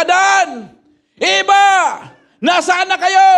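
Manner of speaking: calling out across the garden. Acted. God calling out for Adam and Eve. (tumatawag nang malakas)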